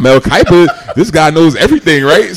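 loud, high and laughing; excited, laughing. Real, not acted. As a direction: exclaim it through a laugh.

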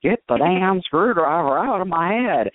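slow, hammed-up bad Southern accent. Severed head in the movie, mock Southern accent. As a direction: drawl it like a cheesy Southern zombie head. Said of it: "in, like, a really bad Southern accent".

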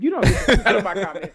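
real laughter breaking through the words; laughing. Real, not acted. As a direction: scold through helpless giggles. Tickled by a viewer's comment.

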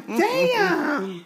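drawn-out mock amazement; replayed astonishment; amazed reaction. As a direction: stretch it out through laughter. Retells amazed reaction, laughing.

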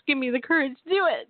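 urgent plea rising in pitch; pleading self-pep-talk. Retold or imitated. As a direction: psych yourself up to act. Acts out praying for nerve.

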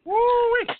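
high loud woo-wee; excited whoop. Real, not acted. Reacting to spicy sauce.

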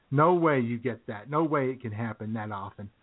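usual speaking voice

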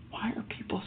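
a fast, breathless whisper to yourself; whispered thought. Retold or imitated. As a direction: hush it like a private, disbelieving thought. Inner thought, whispered to himself.

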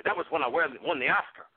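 ordinary delivery

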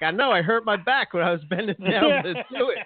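high-pitched retold griping; mock complaint. Retells friend's joking complaint.

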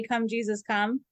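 ordinary talk, nothing marked

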